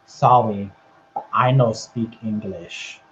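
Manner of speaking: low, slow deadpan reply; mock wrestler quote. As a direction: drop the voice and feign no English. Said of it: "he just goes".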